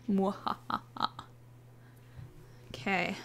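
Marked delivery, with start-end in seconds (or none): 0.1-1.1 soft, slow villain cackle; a sneaky little evil chuckle; mock evil laugh. Player cheating with pickup, mock evil cackle.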